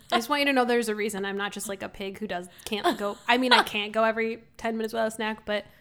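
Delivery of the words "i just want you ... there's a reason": ordinary delivery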